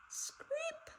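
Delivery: very high, thin creak, rising; spooky high screech sound; high creaking screep. A narrator's reading. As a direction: squeal it like a creaky floorboard. Monster footsteps sound effect.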